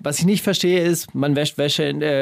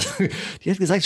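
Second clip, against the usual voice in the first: ordinary talk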